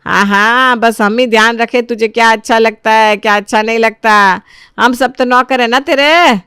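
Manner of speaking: loud, aggrieved mother-in-law rant; mock their wishes with loud scorn; heated, bitter sarcasm; sarcastic outburst.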